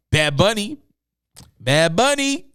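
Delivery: high, rising hype call of the name; excited callout. Real, not acted. Vlogger hyping the next artist to viewers, excited rising callout.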